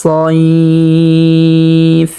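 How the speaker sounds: very slow, drawn-out tilawat chant; slow melodic Quranic recitation. Shown as a demonstration. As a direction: recite each word with long held vowels. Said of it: "যেমন".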